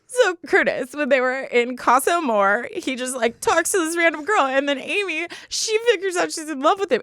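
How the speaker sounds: high, teary voice, half laughing, half crying; tearful rambling. Retold or imitated. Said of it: "And I'm, like, crying and talking about these people".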